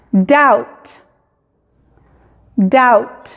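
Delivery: "Doubt" said dowt; teacher demo; silent b. Shown as a demonstration. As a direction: model "Doubt" with no b. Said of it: "Doubt es duda".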